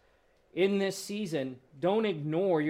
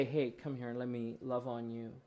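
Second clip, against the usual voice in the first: much softer, lower and gentle; tender invitation; 6 semitones lower, 8 dB quieter than usual. Pretend. God welcoming the anxious person, soft and tender. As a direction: coax a hurting child close. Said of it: "He's gonna say".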